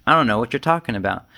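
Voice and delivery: normal talking voice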